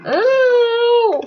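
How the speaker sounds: loud, very high held "ooh"; sudden piercing hoot; high-pitched "ooh". Real, not acted. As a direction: let out a long, high, loud squeal of "ooh". Sudden reaction in game.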